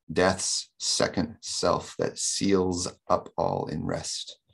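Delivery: slow "Death's second self that seals", lingering on every S; stressed sibilants. Shown as a demonstration. Lecturer showing the line's S's are intentional, slow sibilant emphasis. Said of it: "note all the S's in this line".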